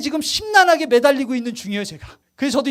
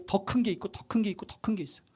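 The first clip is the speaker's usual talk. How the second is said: fading to a whisper; 5 semitones lower, 12 dB quieter than usual. Acted. Preacher drops to hushed repetition.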